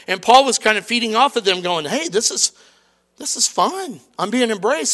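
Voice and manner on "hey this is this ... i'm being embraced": giddy. Retold or imitated.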